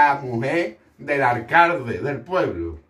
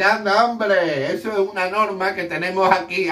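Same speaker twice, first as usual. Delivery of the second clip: laughing mayor; 7 semitones higher than usual. Retold or imitated. The amused mayor laughing it off to the priest. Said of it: "riéndose el alcalde".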